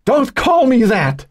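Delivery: very high, drawn-out scream. A narrator's reading. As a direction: scream it slowly at your sister. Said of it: "Joan screamed".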